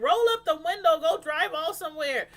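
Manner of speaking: Sky yelling at Donna to roll up the window; imitating Sky yelling. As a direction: shout her down to shut up. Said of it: "Sky is like".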